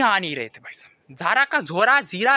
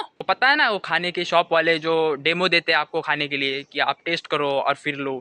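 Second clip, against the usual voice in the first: ordinary talk, nothing marked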